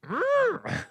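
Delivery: high creaky creature hum; mock with a short strangled "mmm". Mocking creature noise after word.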